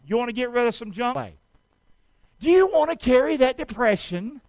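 ordinary talk, nothing marked

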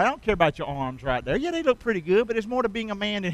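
ordinary delivery

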